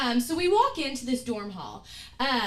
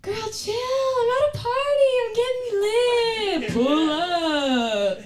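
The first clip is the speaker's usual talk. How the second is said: higher, louder, slow party drawl; lightly voiced partying friend; party-girl friend voice; 10 semitones higher, 6 dB louder, much slower than usual. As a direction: voice the carefree friend.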